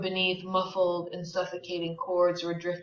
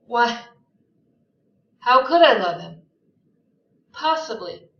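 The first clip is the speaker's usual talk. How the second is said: Daisy's reply said out loud and high, as a pleading protest; 3 semitones higher, 9 dB louder than usual. Acted. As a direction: cry the question out to Gatsby. Daisy answers Gatsby aloud.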